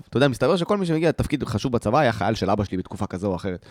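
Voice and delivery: normal talking voice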